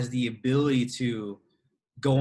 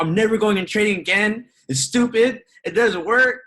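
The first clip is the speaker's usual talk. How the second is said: frustrated outburst; 9 semitones higher, 7 dB louder than usual. Retold or imitated. Retold frustrated vow to quit.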